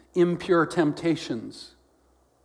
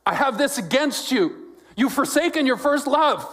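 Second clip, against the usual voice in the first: high, raised-voice rebuke; yell; 11 semitones higher, 4 dB louder than usual. Acted. Jesus warning those who forsook first love, acted yell. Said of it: "And Jesus yells".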